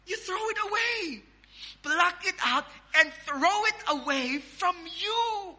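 high, stretched-out plea without shouting; emphatic preached command. Acted. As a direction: draw out each phrase high and insistent.

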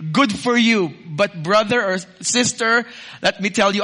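usual speaking voice

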